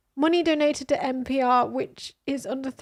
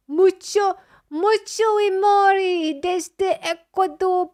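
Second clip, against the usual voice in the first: mock Italian accent; 7 semitones higher, 8 dB louder, much slower than usual. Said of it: "I can't do an Italian accent".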